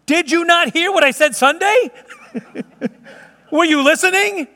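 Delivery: high, incredulous mock scolding; mock exasperation. Pastor to a caller who missed Sunday's sermon, mock exasperation. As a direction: pitch it way up, comically appalled.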